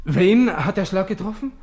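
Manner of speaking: high, loud stunned question; shocked disbelief. Acted. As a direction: ask in breathless disbelief. Startling news from the waiter.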